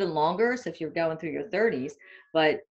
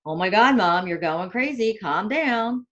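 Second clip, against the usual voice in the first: put-on louder, exasperated kids' plea; lightly voiced kids telling mom to calm down; kids scolding mom; 4 semitones higher, 7 dB louder than usual. Mother imitating her kids telling her to calm down, louder. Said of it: "And my kids would always say".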